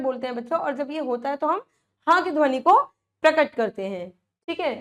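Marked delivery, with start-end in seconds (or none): none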